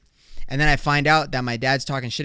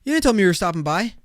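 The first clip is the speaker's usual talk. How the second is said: higher-pitched mock surprised dad; startled dad; 8 semitones higher, 5 dB louder, much faster than usual. Dad finds son in his house, high startled protest. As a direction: blurt it out, caught off guard. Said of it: "He's gonna be like".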